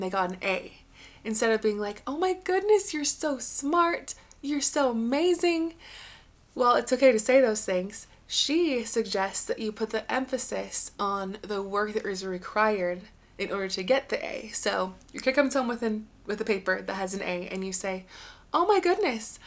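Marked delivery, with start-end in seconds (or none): 2.1-5.7 high-pitched, drawn-out parental gushing; acted amazed praise, pitched up high. Parent gushing over child's good grade. 18.5-19.4 high-pitched delighted parent; acted gush, lifted high; delighted praise. Parent praising child's effort, acted.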